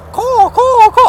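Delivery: high-pitched mimicked crow call; crow cawing. Man amused by crows, imitating their high caws. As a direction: do a crow's repeated caw. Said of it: "The crows are cute".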